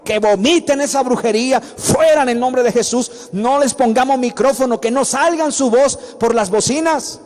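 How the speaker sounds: high, loud pulpit exhortation; rally the church with full force; fiery preaching. Acted. Pastor urging the congregation to cast out demons, preaching at full force.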